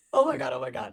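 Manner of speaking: usual speaking voice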